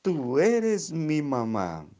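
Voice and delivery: hohe, sehr langsame zärtliche Feststellung; zärtlich. Acted. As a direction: sag es langsam und innig.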